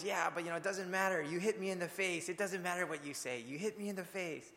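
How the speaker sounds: put-on high, whiny child voice, as if crying; high tearful kid complaining; bawling little girl. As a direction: mimic a hurt little girl tattling through tears. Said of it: "the kid just kept crying, says".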